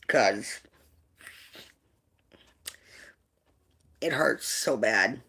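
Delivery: low, soft and slow, pained; real hurt. Real, not acted. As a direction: struggle to get it out, hushed and halting. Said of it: "really hard for me to talk about".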